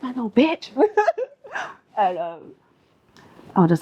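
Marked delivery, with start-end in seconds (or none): 0.0-2.0 slow, higher, laughing through it; laughing mock retort. Remembering her school rival, laughing mock retort. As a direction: laugh while playing the comeback.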